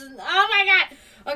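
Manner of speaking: high, loud, drawn-out exclamation; mock-horrified outcry. Real, not acted. Streamer picturing mice in traps, mock-horrified outcry. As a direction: cry out at a silly awful thought.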